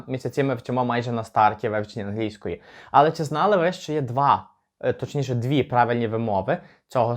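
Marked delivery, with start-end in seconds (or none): none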